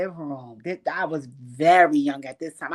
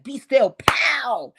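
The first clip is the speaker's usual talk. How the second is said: put-on scolding child; 6 semitones higher, 7 dB louder, much slower than usual. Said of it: "I was like".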